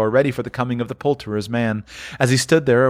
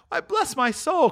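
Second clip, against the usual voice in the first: very high, drawn-out amazement; astonished cry; slower than usual. Acted. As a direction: exclaim slowly in high-pitched surprise. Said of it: "cried Fred".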